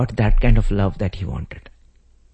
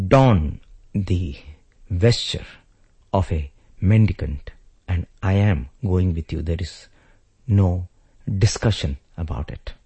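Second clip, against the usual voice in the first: ordinary delivery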